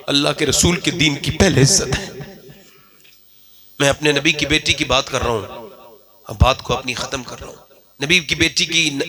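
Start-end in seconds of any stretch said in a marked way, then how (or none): none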